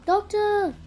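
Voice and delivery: high loud call down the corridor; barked call. A narrator's reading. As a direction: bark it out for the doctor. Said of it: "she barked out again".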